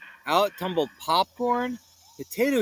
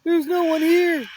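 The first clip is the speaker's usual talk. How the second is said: disappointed; 12 semitones higher, 7 dB louder than usual. Acted.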